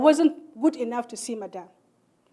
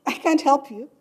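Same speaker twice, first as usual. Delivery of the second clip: laughing refusal; 3 semitones higher, 7 dB louder than usual. Retold or imitated.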